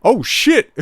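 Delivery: high, loud burst then a laugh; surprised laugh. Real, not acted. Streamer hearing the Uber reply, loud surprised laugh. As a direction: caught off guard, laugh it out. Reacting to clip line.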